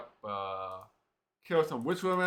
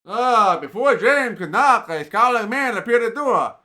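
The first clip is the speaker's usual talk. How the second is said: raised, lively storyteller voice reading the quest text; story narration; 10 semitones higher, 14 dB louder than usual. A narrator's reading. Gamer reads the quest story aloud to viewers, lively narration.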